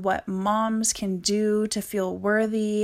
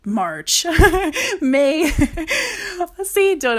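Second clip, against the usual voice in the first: laughing at herself; 8 semitones higher, 7 dB louder, slower than usual. Real, not acted. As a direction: laugh through the words, amused. Laughs at forgetting the date.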